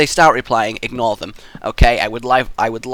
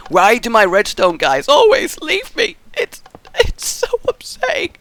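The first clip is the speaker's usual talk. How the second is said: very high, drawn-out whine of mock distress; mock upset wail; 12 semitones higher, 5 dB louder, slower than usual. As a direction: wail slowly, hamming up the hurt. Mock lament over absent redstone helpers.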